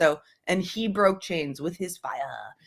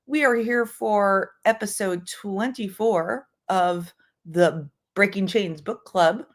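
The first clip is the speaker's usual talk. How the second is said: ordinary talk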